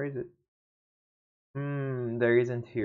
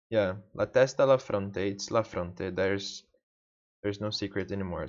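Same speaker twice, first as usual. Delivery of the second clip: normal talking voice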